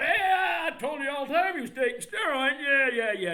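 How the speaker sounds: very high, loud mocking reporter voice. Phony reporter claiming he knew, high and loud mockery. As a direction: shriek it like a phony reporter bragging.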